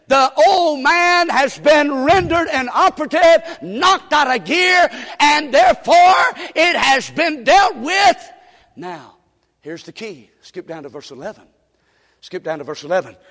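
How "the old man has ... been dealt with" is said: loud, high preaching of scripture; preaching at full force. Acted. Preacher quoting Romans to the congregation, full force. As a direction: proclaim it to the back row.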